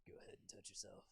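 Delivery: soft, whispery cartoon quote; Big Mouth impression. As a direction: half-whisper it as a show impression. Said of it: "have you seen Big Mouth".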